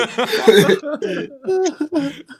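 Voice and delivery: high-pitched laugh. Real, not acted. Big laugh.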